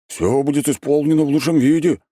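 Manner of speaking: high, loud, keen assent; eager compliance. A narrator's reading. Григорий accepts his orders, eager to please. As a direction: promise it with happy zeal.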